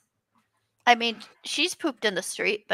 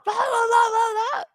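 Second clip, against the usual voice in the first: emotional rant; 11 semitones higher, 4 dB louder, much slower than usual. Retold or imitated. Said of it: "when he gets really emotional".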